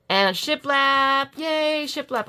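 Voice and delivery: drawn-out, high-pitched little cheer; delighted cheer. Real, not acted. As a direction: sing out your delight, stretching the words. Delighted reveal of shiplap paper.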